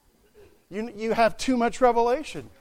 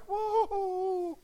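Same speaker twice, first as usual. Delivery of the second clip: high, soft, drawn-out mock delight; airy imitation of a carefree drifter; oblivious slider; 9 semitones higher, 5 dB quieter, much slower than usual. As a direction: coo it as if gliding blissfully downhill. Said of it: "you're just like".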